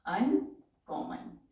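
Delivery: drawn out and even; slow dictation. Shown as a demonstration. Teacher dictating a word to shorthand class, slowly.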